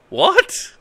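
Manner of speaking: high, rising disbelieving what; baffled what. Real, not acted. Streamer stumped by the manual, high rising what. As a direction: let the pitch jump in confusion.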